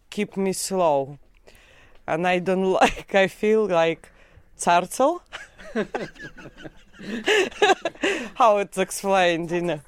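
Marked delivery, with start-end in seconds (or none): 5.3-6.7 sudden high-pitched giggle; high laugh. Real, not acted. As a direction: burst into a squeaky laugh. Laughs at feeling like turtle.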